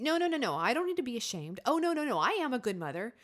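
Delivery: mock protesting, high loud strings of no; false comfort voice. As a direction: argue back defensively at the shame voice. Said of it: "the false comfort voice".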